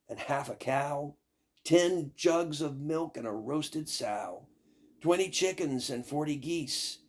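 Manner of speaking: ordinary delivery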